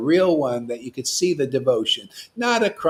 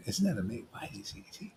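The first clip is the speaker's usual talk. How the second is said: soft unvoiced whisper; 4 semitones lower, 14 dB quieter, much faster than usual. Real, not acted. Preacher whispering a cheeky double meaning. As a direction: breathe it like a secret.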